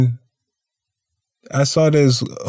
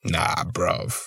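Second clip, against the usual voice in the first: British slang, softer; 5 semitones lower, 9 dB quieter than usual. Retold or imitated. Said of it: "that's that English shit".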